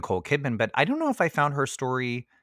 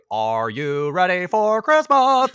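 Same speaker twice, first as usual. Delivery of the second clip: high, loud, slow talk-show host voice; Oprah voice; 8 semitones higher, 8 dB louder, slower than usual. Retold or imitated. Mimicking Oprah for a friend, big host voice. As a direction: boom it out like a TV host. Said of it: "it's the Oprah voice".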